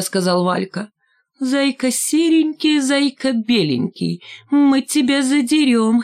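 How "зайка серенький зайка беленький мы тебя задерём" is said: high, slow mocking chant; drawn-out gleeful playground chant; teasing giggly singsong. A narrator's reading. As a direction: taunt in a thin singsong fox voice. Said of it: "хихикнула Шмакова".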